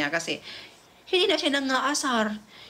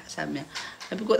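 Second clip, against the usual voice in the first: ordinary talk, nothing marked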